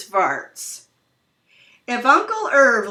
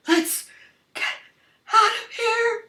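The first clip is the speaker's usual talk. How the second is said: very high panicked yelp to flee; choking burglar's frantic cry; 13 semitones higher than usual. Acted. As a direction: squeal it out in panic.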